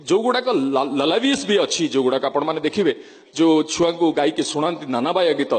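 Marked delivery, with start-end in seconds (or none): none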